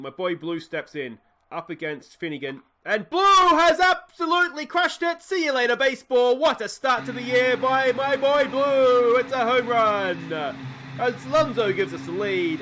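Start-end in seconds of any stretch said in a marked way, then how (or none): 2.8-12.6 screaming high and loud with joy; home run celebration. Real, not acted. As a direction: roar it out like the crowd just went wild.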